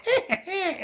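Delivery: high laughing mock yells; sputtering foiled villain. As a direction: sputter after him, laughing. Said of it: "continues to run away like".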